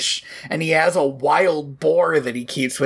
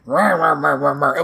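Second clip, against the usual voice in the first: grumbling; 3 semitones lower than usual. Retold or imitated. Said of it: "He's just like".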